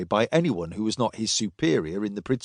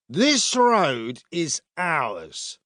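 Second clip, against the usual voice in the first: put-on slow, higher drunken claim; drunk soldier; 5 semitones higher, much slower than usual. A narrator's reading. Drunk soldier blocking two travellers' way, slow and slurred.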